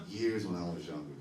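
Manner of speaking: usual speaking voice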